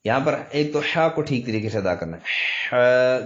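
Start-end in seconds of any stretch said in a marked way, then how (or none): 2.7-3.2 "حا" from the middle of the throat, held long; a long breathed "حا" made mid-throat. Shown as a demonstration. Teacher shows where the letter is made, drawn-out throaty sound. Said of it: "گلے کے درمیان سے".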